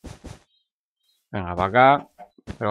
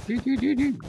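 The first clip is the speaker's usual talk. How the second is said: high little ti-ti trill; playful high vocal beeps; silly beeping; 3 dB quieter than usual. Pretend. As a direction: squeak out tiny falsetto beeps. Playful noises while building.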